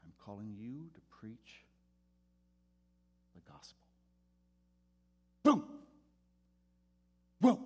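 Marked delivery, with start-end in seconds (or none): 0.0-3.8 quiet, tender, unhurried call, much softer; soft loving voice of God. Retold or imitated. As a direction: speak it like a gentle voice from within. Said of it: "just very soft, very loving".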